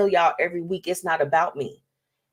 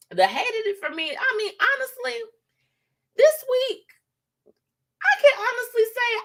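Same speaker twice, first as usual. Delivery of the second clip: high playful. Real, not acted. High playful segment intro.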